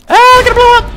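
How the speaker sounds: very high, loud squeal of joy; hammed-up ecstatic shriek; overjoyed outburst. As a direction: burst out as if exploding with glee. Overjoyed at dad's praise.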